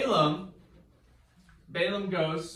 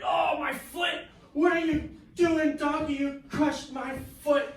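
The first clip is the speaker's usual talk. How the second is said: high, loud mock cry of pain; hammed-up pained yell; 9 semitones higher, 3 dB louder than usual. Balaam yells at the donkey that crushed his foot against a wall.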